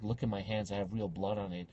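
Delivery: low, hushed creepy voice; menacing haunted-house character. Retold or imitated. Scare actress shows off her bloody hands, low and menacing. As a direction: quietly menace them, well below your pitch. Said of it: "she's like".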